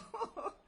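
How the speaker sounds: high-pitched burst of laughter; amused laugh. Real, not acted. Teacher laughing at the fellow who claims eternity, amused. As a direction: laugh out at the absurdity.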